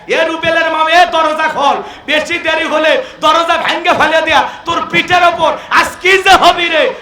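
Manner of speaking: hammed-up furious husband bellowing at the door; angry husband at the locked door, yelling to be let in; rough husband yelling. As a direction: shout and threaten the wife through the door like a brute. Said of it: "আমাদের ব্যবহার তো এত মিষ্টি না".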